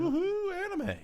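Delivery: excited cheer. Real, not acted. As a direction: cheer it like a fan. Cheering the next segment.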